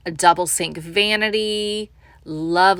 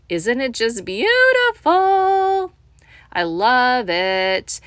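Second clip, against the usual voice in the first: much higher, slow, drawn-out gush; gushing delight; 4 semitones higher, 3 dB louder, slower than usual. Real, not acted. Realtor swooning over the dining table, gushing and drawn out. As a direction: swoon over it like it's perfect.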